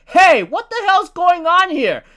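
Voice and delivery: high, loud, hammed-up angry yell; annoyed yell. Rocks storming in, angrily demanding what's going on. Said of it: "Roxy Sebic is really annoyed".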